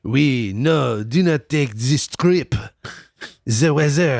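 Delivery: slow, thick put-on French accent. French saucier warning the king off his trip, in a French accent. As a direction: plead like a cartoon Frenchman. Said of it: "A French guy in Scotland".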